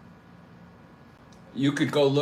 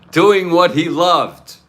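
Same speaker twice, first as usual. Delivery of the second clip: slow, loud, higher punchline; mock solemn; 4 semitones higher, 11 dB louder, slower than usual. Real, not acted. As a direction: deliver it slowly and grandly. Punchline about a death in the saddle.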